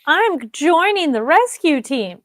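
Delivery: slow, high mock-heroic declaration; hammed-up publicity-stunt voice; showy announcement. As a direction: announce it grandly, drawn out.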